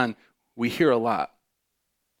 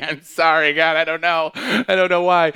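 flustered apology; 4 semitones higher, 6 dB louder than usual. Pretend. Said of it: "I'd be like".

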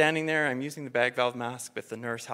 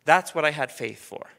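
ordinary talk

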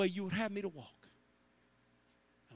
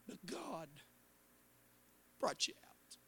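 whisper; 9 dB quieter, slower than usual. Real, not acted. Preacher drops to a whisper.

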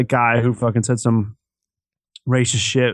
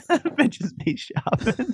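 laughing; 4 dB quieter, much slower than usual. Real, not acted. Laughing while repeating old catchphrase.

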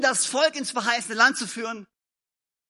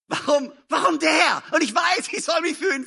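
envious whine; 7 semitones higher than usual. Acted.

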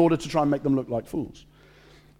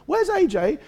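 put-on child asking; 13 semitones higher than usual. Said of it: "he went up to Rachel and said".

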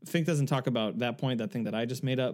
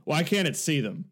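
raised, mildly loud mock question; very loud husband; 4 semitones higher, 4 dB louder than usual. Imitating her husband asking in the cinema, too loud. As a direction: imitate a puzzled moviegoer talking too loudly. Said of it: "he just says very loudly".

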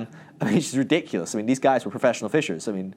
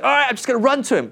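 high, loud, fast burst; comic gung-ho haste; eager rush; 12 semitones higher, 6 dB louder, faster than usual. Acted. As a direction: blurt it while setting off running. Acting out Peter dashing off.

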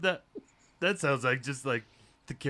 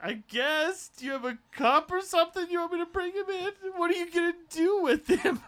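high flustered voice; 5 dB louder than usual. Acted. Flustered assistant after beating.

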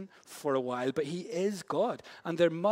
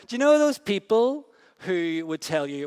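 much louder and higher restart; 5 semitones higher, 10 dB louder than usual. Acted. Restarting the question to a laughing audience, loud. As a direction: project it out over the laughter. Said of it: "What are you laughing at?".

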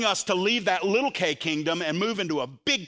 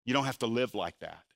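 gentle; 7 semitones lower, 5 dB quieter than usual. Acted. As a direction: lower and softer, gentle. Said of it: "He's saying".

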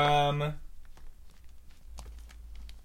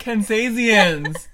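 Kansasians drawn out slowly, higher and louder; mocking repeat; 10 semitones higher, 12 dB louder, much slower than usual. Real, not acted. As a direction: savour the silly word to tease her. Teasing the made-up word.

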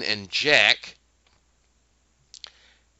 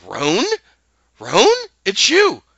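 surprised recognition; 12 semitones higher, 11 dB louder, slower than usual. Retold or imitated. Recognizes an old friend.

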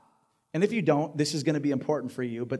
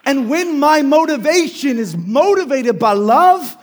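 preaching at full force; 10 semitones higher, 9 dB louder than usual. Acted. Pastor urging his church to serve from gospel love, full preaching voice. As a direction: drive it home to the room like a revival preacher.

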